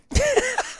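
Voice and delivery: squeaky high laugh; high giggle. Real, not acted. Laugh after silly voice.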